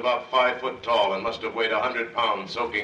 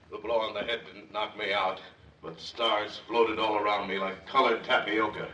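usual speaking voice

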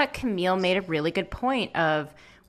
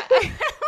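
burst of laughter; 5 dB louder than usual. Real, not acted. Laughing at her dad's accent.

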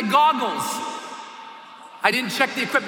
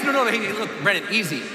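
tag; 5 semitones lower, faster than usual. Retold or imitated. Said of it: "I thought he was high".

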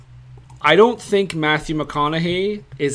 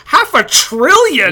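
slow, higher and louder disbelief; incredulous; 8 semitones higher, 6 dB louder, slower than usual. Retold or imitated. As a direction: stretch it out in mock disbelief. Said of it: "I'm like".

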